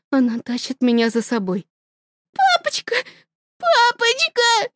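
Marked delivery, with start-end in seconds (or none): 2.3-4.7 put-on much higher, small child's call; little girl calling daddy; frightened child. (высоким детским голосом)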